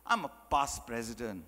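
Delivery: low, soft, solemn boast; self-important past president. Acted. Past president brushing off a hungry child, solemnly pompous. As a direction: announce the title with grave self-importance. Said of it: "that's how ridiculous it sounds".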